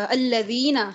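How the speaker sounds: अल्लज़ीना begun with a short "a"; अल्लज़ीना said as "al-lazeena"; fatha start. Shown as a demonstration. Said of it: "तो आपने उसके ऊपर जबर डाल दी".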